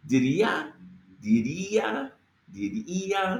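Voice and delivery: Diría with the stress on the í, di-RÍ-a; accent on the i. Shown as a demonstration. Teacher stressing the accented í in Diría for students. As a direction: land on the í of Diría, rising. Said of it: "There's an accent on the E A".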